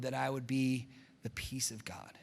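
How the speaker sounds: soft whispered "that I would be the peace of God"; hushed prayer to the Lord. Real, not acted. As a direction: pray it under the breath.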